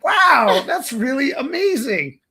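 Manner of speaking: high, loud eager wonder; wide eyed naive. Pretend. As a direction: act dazzled and sincere. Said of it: "You're so good at pretending human".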